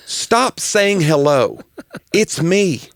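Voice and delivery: slow, emphatic comic exasperation; worked-up pet-peeve rant; up in arms. Real, not acted. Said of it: "strange thing to be up in arms about".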